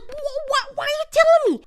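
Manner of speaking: high, squeaky mock husband, stammering; high-pitched, flustered husband protesting; mock panicked husband. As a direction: play the husband, horrified at the news. Husband voiced in a quarrel.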